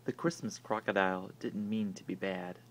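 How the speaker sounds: ordinary delivery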